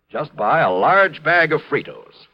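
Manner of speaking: normal talking voice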